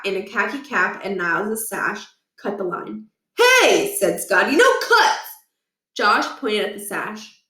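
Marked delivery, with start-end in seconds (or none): 3.4-3.9 indignant call. Acted. Kid objects to line cutting. 4.6-5.3 indignant call. Acted. Kid objects to line cutting.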